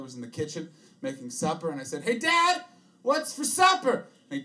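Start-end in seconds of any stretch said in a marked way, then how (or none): 2.0-4.0 put-on high, slow, sing-song kid voice; breezy as if nothing happened; cheery kid. Teen son home from school asks dad, high and chirpy.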